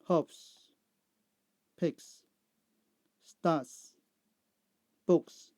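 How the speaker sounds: isolated words, each closing on hissed "s"; S endings. Shown as a demonstration. Teacher repeating answers for learners, clear S endings. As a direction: read the list carefully with crisp final S. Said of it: "S sound".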